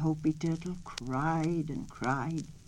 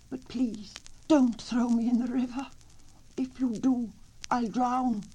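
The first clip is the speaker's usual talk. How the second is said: higher, lightly voiced turtle pleading; brave little turtle; 7 semitones higher, 4 dB louder than usual. A narrator's reading. Turtle bluffing the coyote, higher and pleading. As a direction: play brave, then beg. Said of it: "answered Mister Coyote bravely".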